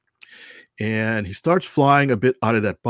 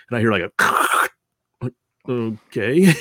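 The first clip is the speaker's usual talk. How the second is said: laughing; much slower than usual. Real, not acted. Radio answer finally comes back.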